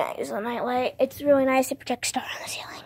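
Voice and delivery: hushed whisper about the nightlight; soft bedtime whisper. Real, not acted. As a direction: whisper it so nobody wakes. Showing nightlight quietly at night.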